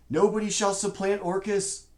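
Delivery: booming, high and loud wand voice. Acted. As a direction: boom it out like an overlord. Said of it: "In this booming voice".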